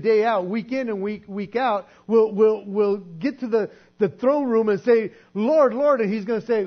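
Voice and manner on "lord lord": loud pleading call of Lord, Lord; loud desperate Lord, Lord; crying out. Acted. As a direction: call out Lord, Lord loud, a little higher, begging.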